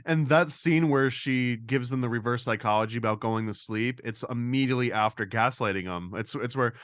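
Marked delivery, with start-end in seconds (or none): none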